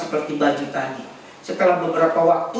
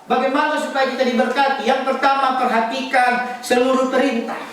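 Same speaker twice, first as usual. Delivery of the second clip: high, ringing preacher's voice; insistent sermon delivery, pitched well up; emphatic preaching; 10 semitones higher than usual. Real, not acted. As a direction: exhort the congregation at a high pitch.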